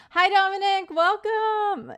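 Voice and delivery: high, loud welcome for a long-absent friend; excited greeting. Real, not acted. Streamer greets a viewer who has been away, excited. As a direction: call it out high and delighted to see them.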